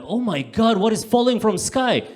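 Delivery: high, loud startled cry; mock panic. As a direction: shriek in fake alarm. Acted passer-by panic.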